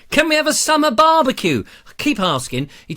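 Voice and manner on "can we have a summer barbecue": high, loud pleading. Pretend. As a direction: beg for it. Reading comment in put-on voice.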